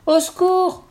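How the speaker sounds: desperate loud shout; roaring for help. A narrator's reading. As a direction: yell to get his friends' attention. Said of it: "rugit le vrai Léon".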